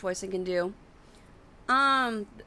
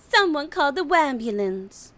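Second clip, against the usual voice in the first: Mercy voice; 6 semitones higher than usual. Retold or imitated.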